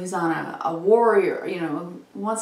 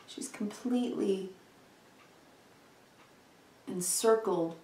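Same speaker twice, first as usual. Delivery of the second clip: awed hush; 8 dB quieter than usual. Real, not acted. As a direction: marvel quietly at the card. Marvelling softly at the card.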